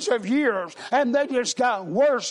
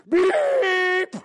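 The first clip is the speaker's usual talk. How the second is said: a shouted "Beep!" imitating a car horn; 7 semitones higher than usual. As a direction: honk like an impatient driver behind you. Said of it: "that guy behind us went".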